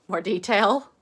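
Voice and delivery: ordinary delivery, nothing marked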